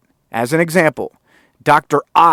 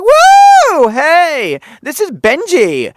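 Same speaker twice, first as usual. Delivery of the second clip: whooping high, loud greeting; like he's mid-workout at a party; a screechy woo, then a bright hello; party-mode Benji; 9 dB louder, much slower than usual. Retold or imitated. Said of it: "just a twenty-four hour party".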